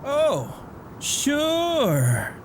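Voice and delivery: high, drawn-out mock agreement; sarcastic. Dad mocking his son's excuse, sarcastic and high. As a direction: sneer at the excuse with a lilt.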